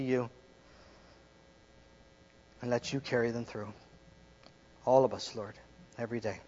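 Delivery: prayerful pastor voice; earnest closing prayer. Acted. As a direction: pray it earnestly to close.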